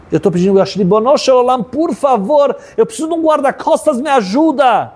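impassioned prayer climbing high; acted boy imploring God; desperate plea. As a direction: beg for help, rising to a high cry. Retold crying boy begs God.

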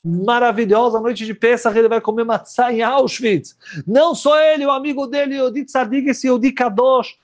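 high, slow rejoicing; exultant storyteller; joyful excitement. A narrator's reading. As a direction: lift them up with his joy. Joy at carrying the matzot.

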